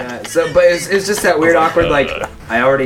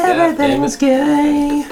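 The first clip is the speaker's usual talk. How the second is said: slow, much higher sing-song repeat; drawn-out mocking echo; much slower than usual. Friend mockingly echoes the joke in a high drawn-out voice. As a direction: stretch it out high and silly.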